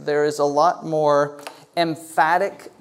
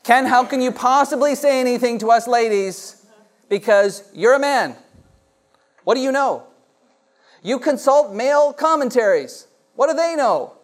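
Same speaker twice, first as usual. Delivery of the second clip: lightly voiced mock protest, higher; mock indignant women; 7 semitones higher, 4 dB louder than usual. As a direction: playfully ham up the ladies' objection. Pastor voicing women's objections.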